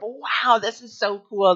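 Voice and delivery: put-on wide-eyed childlike excitement; childlike wonder. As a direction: gasp it out like a kid seeing magic. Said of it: "that childlike wonder of".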